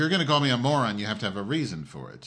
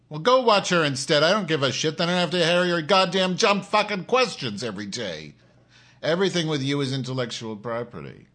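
angry rant; 5 semitones higher, 4 dB louder than usual. Real, not acted. Ranting back at a chat viewer.

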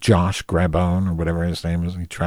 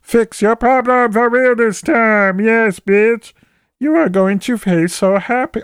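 very high, loud, slowed syrupy cheer; squealing overeager agent; 5 dB louder than usual. Retold or imitated. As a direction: shriek false delight at the customer. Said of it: "She said".

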